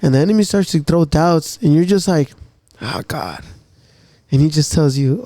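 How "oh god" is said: much lower and softer, a broken whisper of a plea; despairing sigh. Retold or imitated. Believer crying out to God under doubt, broken and hushed. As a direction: let it sink out of you in defeat.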